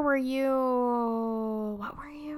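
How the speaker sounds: befuddled devil. Acted. Devil trying to place a dead soul, befuddled. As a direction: stretch it out, hunting for the memory.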